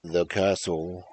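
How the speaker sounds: slow, drawn-out spooky title; dramatic title announcement. Pretend. Finally naming the story for viewers, slow and dramatic. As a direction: stretch out every word like a horror movie title.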